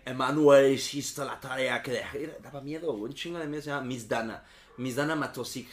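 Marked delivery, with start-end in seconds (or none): 0.0-2.2 put-on loud, slow stern teacher with a Czechoslovak accent; imitating a big Czechoslovak teacher's voice. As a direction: call the pupil's name like a scary Czechoslovak teacher. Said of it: "con una voz grande y con acento como".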